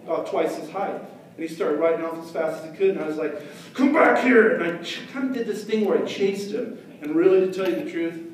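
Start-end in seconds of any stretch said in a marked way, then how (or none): 3.7-4.6 loud higher yell; shouting after him. Retold or imitated. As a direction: yell after the fleeing kid. Chasing the kid.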